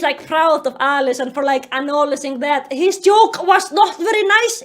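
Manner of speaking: plain reading voice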